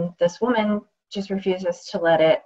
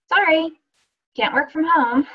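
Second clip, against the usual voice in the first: a lightly put-on dismissive office clerk, pitched higher; mock office voice; 9 semitones higher, 4 dB louder than usual. Mimicking the office turning down her request, lightly dismissive. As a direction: brush her off like a bored receptionist.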